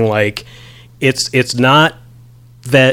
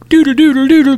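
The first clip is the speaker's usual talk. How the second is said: mock wavering hum; 4 dB louder, faster than usual. Mimicking wavering back and forth.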